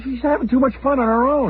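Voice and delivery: husband fretting to the host, high whine; high whiny worry. Retold or imitated. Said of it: "I said".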